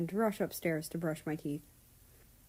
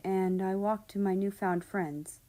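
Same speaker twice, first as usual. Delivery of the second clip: plain reading voice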